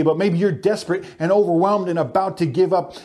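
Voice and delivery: ordinary talk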